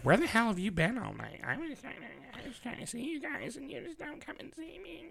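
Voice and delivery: high, soft, whiny pet voice; whiny cat voice. Pretend. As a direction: play the sulking cat, high and fading. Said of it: "She's like".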